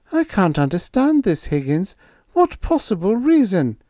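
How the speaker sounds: put-on young woman's voice pitched high, puzzled; Babs demanding. A narrator's reading. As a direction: press him for a reason.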